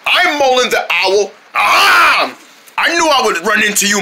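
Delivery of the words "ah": high, short startled cry; sudden yell. Acted. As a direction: yelp out at the reveal. Stranger startles on meeting Mullen.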